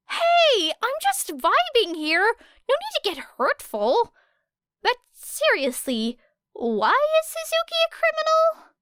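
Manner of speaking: put-on high, clueless young guy asking; Kaminari's voice. A narrator's reading.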